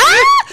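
sudden high squeal of frustration; a high exasperated shriek; mock frustrated yelp. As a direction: yelp it like you can't take it anymore. Frustrated outburst at roommate.

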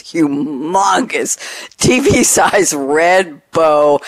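HUMONGOUS stretched out slowly; drawn-out emphasis. Real, not acted. Buyer describing the bow on her car, HUMONGOUS drawn out. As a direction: stretch "humongous T V size red bow" word by word.

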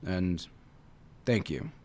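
quiet, bashful mumble of thanks; mumbled thanks. A narrator's reading. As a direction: grudgingly mumble your thanks. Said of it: "the tiger added in a mumble".

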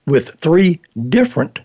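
usual speaking voice

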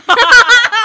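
loud high laugh. Real, not acted. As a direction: burst out laughing. Laugh mid banter.

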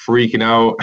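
ordinary talk

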